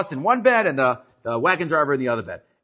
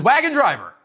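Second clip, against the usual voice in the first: higher, louder sharp call; sudden call; 9 semitones higher, 4 dB louder than usual. Retold or imitated. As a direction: catch him off guard with a shout. Rabbi calls out suddenly.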